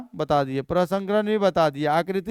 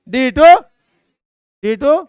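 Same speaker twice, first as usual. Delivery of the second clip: "डी टू" punched high and very loud; emphatic correction; 6 semitones higher, 11 dB louder, slower than usual. Shown as a demonstration. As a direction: drill "डी टू" loudly, pitched well up. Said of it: "square नहीं पढ़ेंगे".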